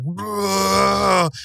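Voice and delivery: long, high mock scream held at normal volume; imitated slow-motion scream. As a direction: stretch it out like a slow-motion yell. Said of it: "like, screaming".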